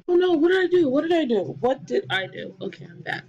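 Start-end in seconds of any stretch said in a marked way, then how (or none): 0.1-1.6 panicked. Real, not acted. Mistake in game, panicking.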